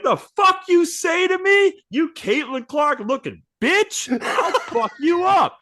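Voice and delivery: very high, loud, laughing fury; mock rage. Angel Reese threatening the monster, high mock fury. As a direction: squawk a fake threat. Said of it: "And she's like".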